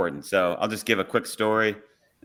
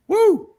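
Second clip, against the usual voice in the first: high, loud whoop of breath; imitated out-of-breath whoop; winded "woo"; 8 dB louder than usual. Shown as a demonstration. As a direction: blow out a big "woo" as if catching your breath. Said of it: "trying to catch your breath".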